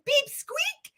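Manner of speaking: high, squeaky mock voice; mimicked punchline. Mimicking the man in the clip she picked, high mocking voice. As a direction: squeak out his catchphrase. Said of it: "and he's like".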